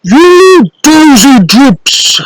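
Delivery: giant roaring. Acted. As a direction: roar over the wet sheets. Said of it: "roared the giant".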